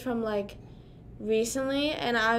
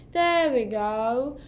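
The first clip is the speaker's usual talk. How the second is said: put-on slow, louder British-accented there we go; playful fake British accent, stretched out; 6 dB louder, much slower than usual. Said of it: "talking in a British accent".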